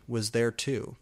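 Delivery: ordinary delivery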